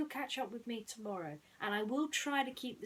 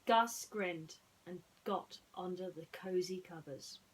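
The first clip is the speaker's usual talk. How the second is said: ordinary delivery, nothing marked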